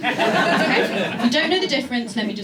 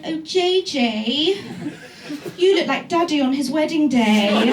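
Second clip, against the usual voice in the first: posh tipsy mum; 5 semitones higher, 5 dB louder than usual.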